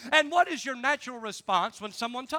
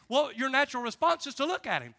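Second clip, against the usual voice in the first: usual speaking voice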